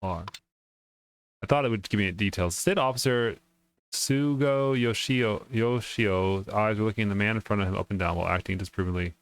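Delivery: ordinary talk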